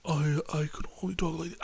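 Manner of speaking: very soft, strained whispery villain; hushed mocking film-villain impression; Eddie Redmayne. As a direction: barely squeeze the words out, mock-villain style. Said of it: "Eddie Redmayne doing his impression".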